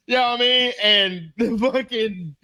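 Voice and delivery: usual speaking voice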